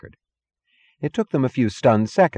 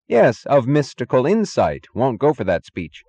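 louder, higher, decided assertion; firm; 6 semitones higher, 5 dB louder than usual. A narrator's reading. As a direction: repeat it firmly, with regained confidence. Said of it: "so he repeated firmly".

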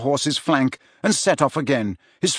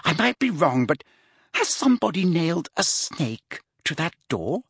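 put-on higher girlish voice, puzzled; incredulous girl; 5 semitones higher than usual. A narrator's reading. Girl to Tom about the nailed snake, incredulous. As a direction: ask it in delicate disbelief.

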